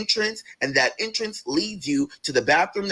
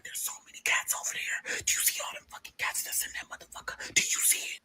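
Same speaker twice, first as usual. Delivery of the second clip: retold cousin's whisper; breathy whisper about the cats; 11 dB quieter than usual. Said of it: "She was like".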